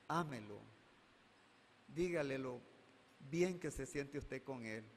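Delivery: grave, suave y pausado; aconséjala con cariño; tierno consejo. Real, not acted. Consejo pastoral a la madre. (pastor aconsejando a la madre, con ternura)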